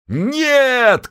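high shouted no; chorus shout. Acted. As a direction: yell it as a chorus. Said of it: "крикнули крестоносцы любви".

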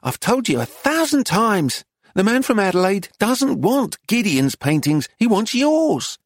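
high, exasperated scolding; cross, high-voiced shopkeeper. A narrator's reading. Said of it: "raised the pitch of her voice".

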